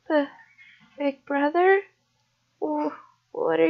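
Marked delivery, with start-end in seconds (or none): none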